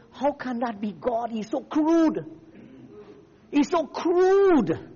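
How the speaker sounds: mock outrage climbing high, building to a drawn-out cry; outraged critics. Preacher voicing people with the wrong Jesus, mock-scandalized. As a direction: imitate offended people, rising in pitch and force. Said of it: "the people with the wrong Jesus were saying".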